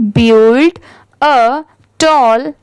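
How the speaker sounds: very slow, each word drawn out for learners; slow read-aloud. Shown as a demonstration. Teacher slowly reading a sentence to students, drawn out. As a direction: spell it out slowly so the class can follow. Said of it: "इसे हम क्या पढ़ेंगे?".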